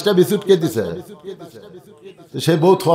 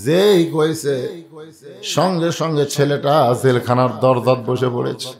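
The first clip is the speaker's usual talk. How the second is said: normal talking voice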